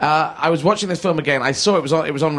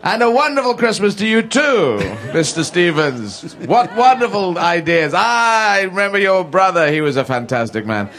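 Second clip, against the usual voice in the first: effusively positive; 3 semitones higher than usual. Acted. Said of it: "just say positive things back to someone giving you all this bile".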